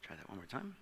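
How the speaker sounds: quiet aside to himself, slowed right down; under the breath, fiddling with the clicker; muttered aside. Real, not acted. Speaker mutters to himself as the slide fails, quietly.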